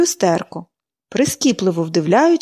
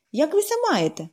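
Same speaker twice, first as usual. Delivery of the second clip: higher, chirpy greeting; chirping; 8 semitones higher, 4 dB quieter than usual. A narrator's reading. Pollyanna chirping hello to the stranger, higher. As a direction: chirp it like a little bird. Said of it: "защебетала вона".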